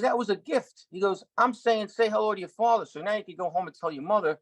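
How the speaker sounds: high, tough-guy Pesci imitation; a light impression of a wise-guy mentor; Joe Pesci voice. Joe Pesci teasing the young actor, tough-guy voice.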